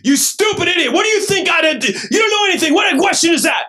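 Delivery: very high, very loud contemptuous shouting; furious yelling. Retold or imitated. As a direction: scream insults at the young pastor. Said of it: "yelled at me for forty-five minutes".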